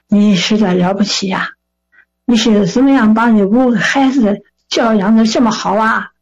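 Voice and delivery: put-on admiring old man's Shandong accent; imitated Shandong elder; Shandong dialect. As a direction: praise them in a Shandong accent. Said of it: "用山东话说".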